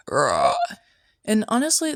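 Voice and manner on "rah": deep guttural zombie rah; zombie groan. Retold or imitated. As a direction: grunt it like a zombie cashier. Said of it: "And he was like".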